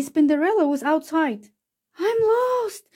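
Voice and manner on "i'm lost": high, lost little wail. A narrator's reading. Lost little spider wails alone outside the school, high and tearful. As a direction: cry it out like a lost child. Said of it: "she wailed".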